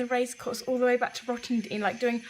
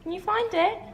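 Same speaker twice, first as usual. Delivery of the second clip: pet talk; 9 semitones higher than usual. Real, not acted. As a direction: coax the dog to find his treat. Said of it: "Why do you always talk like that to, like, pets?".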